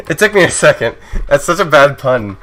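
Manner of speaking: high, loud, amused complaint through laughter; real laughter, playfully exasperated; laughing. Real, not acted. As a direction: protest the terrible pun while cracking up. Laughing at a bad pun.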